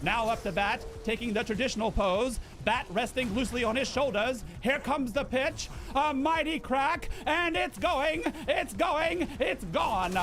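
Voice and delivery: put-on old-time radio play-by-play in a Mid-Atlantic lilt; Mid-Atlantic radio voice building up at the hit; Mid-Atlantic announcer. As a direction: call the game like a 1950s broadcaster. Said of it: "the Mid-Atlantic era".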